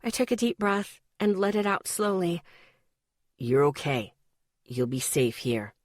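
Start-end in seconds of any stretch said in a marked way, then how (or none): 3.4-5.7 reassuring lover. A narrator's reading. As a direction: promise her safety, low and steady. Ethan reassuring her.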